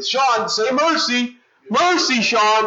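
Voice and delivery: high-pitched, insistent big-brother taunt; taunting demand. Acted. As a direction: demand surrender, drawing out the first words.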